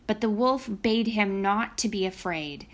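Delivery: usual speaking voice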